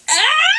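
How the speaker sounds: high shrieking burst of laughter; a real loud cackle, laughing scream. Real, not acted. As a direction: crack up at your own joke, loud and high. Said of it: "That was a good one".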